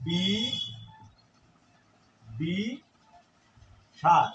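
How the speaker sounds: বি, দি, সার said as separate syllables with pauses; split into syllables. Shown as a demonstration. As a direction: break বিদিশার into বি, দি, সার. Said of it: "শব্দটি হচ্ছে".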